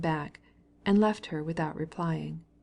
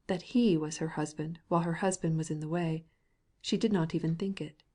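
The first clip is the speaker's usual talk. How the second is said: ordinary delivery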